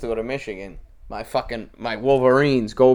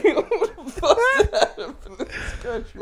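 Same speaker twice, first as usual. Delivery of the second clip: much higher, laughing throughout; laughing mock quote; 13 semitones higher than usual. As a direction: squeak it out laughing. Quoting, laughing.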